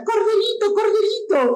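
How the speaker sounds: very high-pitched doting mother's cheer; coo-shout a pet name in a high put-on voice; mom calling out. Mom cheering her five-year-old at football, high mock yell. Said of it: "la mamá le grita".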